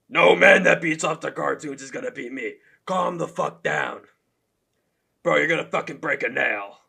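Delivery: loud impression of a trash-talking fighter; mock trash talk, loudly imitated; Sean Strickland. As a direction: taunt like a fighter, lightly put-on voice. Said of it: "I have Sean Strickland at number three".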